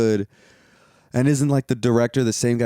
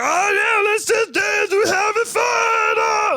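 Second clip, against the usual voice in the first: much higher mock shout of a silly partygoer; mocking giddy higher shout; mocking a giddy character. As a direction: ham up a dopey party voice. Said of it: "he's like".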